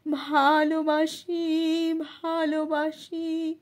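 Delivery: slow, long-drawn echoing refrain; calling from afar. Acted. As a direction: stretch each one like a distant call. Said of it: "দূর থেকে ধ্বনি তুলো". (দূর থেকে ভেসে আসা দীর্ঘ ধ্বনি)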